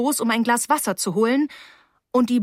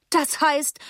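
high, frosty teacher; 7 semitones higher than usual. A narrator's reading. Teacher questioning the new girl, frosty. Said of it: "sagte Miss Becks frostig".

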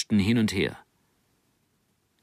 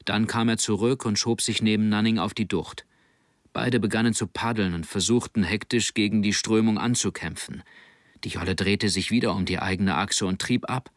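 ordinary delivery, nothing marked

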